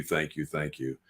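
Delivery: usual speaking voice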